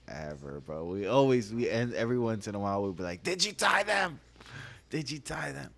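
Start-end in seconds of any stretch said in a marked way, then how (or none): 3.2-4.2 high-pitched teasing imitation; hammed-up catchphrase, pitched way up; mock outrage. Running catchphrase mimicking the cousin. 4.9-5.7 softer, raised-pitch echo; toned-down mimicry. Catchphrase repeated more quietly.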